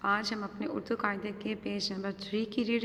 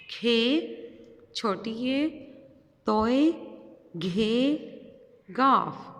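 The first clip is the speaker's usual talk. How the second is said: letters called out louder, with long pauses; letter drill; 3 dB louder, much slower than usual. Shown as a demonstration. Teacher calling letters for children to follow, drill-style. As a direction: drill each letter for the class. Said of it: "मेरे साथ reading करें".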